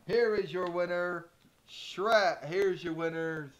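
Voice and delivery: loud, drawn-out winner call; ring announcer. Pretend. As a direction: announce the champions to the arena.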